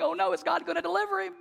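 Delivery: fast, high, fretful mock panic; a king anxiously asking himself; hand-wringing worry. Worried king fretting aloud whether God will save Daniel, fast high mock panic. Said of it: "wringing his hands going".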